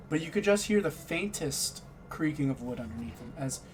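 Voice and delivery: ordinary talk, nothing marked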